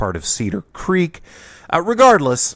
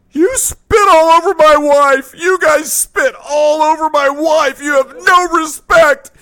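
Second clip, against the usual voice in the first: furious shout; 7 dB louder than usual. Retold or imitated. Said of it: "he gets in both of our faces and goes".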